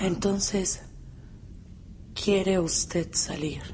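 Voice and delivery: slow, mildly reproachful question; a quiet, drawn-out reproach. Acted. Aura reproaches the young man for leaving: slow, mild reproach. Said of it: "Lo dice como un reproche".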